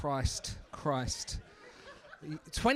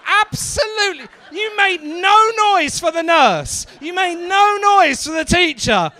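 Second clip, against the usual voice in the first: outraged shouting; 8 semitones higher, 10 dB louder than usual. Acted. Comedian scolding the crowd for no noise for nurse, outraged shout. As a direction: berate the room in mock fury.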